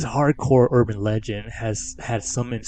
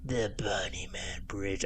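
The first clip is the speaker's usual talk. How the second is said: put-on slow, eerie child narrator voice; lightly voiced creepy kid announcer; eerie little kid voice; slower than usual. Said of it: "has this little kid's eerie voice".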